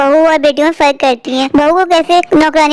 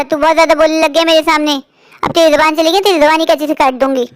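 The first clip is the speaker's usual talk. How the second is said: normal talking voice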